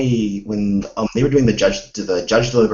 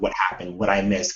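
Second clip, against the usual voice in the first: normal talking voice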